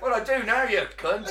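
high, loud laughing comeback; mock indignant retort. Podcaster answers as the smothered victim, mock indignant. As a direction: snap back high, through laughter.

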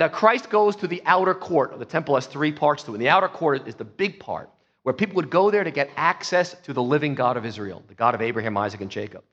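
normal talking voice

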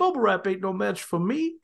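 silly mock bravado. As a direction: imitate a comic tough line, lifting the last word. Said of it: "so stupid and so silly".

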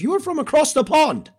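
a deliberately bad English accent, high and overdone. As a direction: put on a clumsy English accent for the UK viewers. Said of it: "That was a horrible English accent".

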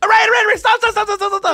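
very high, squealed mock pleading; hammed-up cowardly panic; panicked begging. Cornered man begging boyfriend to stop, mock panic.

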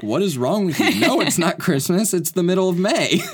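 put-on high, loud incredulous kid voice; mock an annoyed boy shouting back; exasperated kid. Shoveling kid answering a man at the window, loud and high. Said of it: "poor kid just shoveling the driveway is like".

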